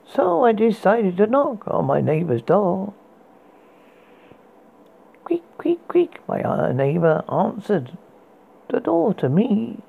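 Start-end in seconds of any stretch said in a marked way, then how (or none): 5.2-6.2 high breathy creak whispered. A narrator's reading. Creaking sound effect voiced.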